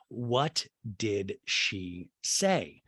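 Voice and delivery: very slow, word by word; deliberate demand. Retold or imitated. Castmate presses Crystal for an answer, slow and deliberate. Retold emphatic repeated demand.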